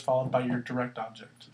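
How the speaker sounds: usual speaking voice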